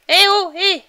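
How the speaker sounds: loud high call to get attention. Real, not acted. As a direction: shout to someone far off. Appelle le personnage au loin. (appel fort et aigu)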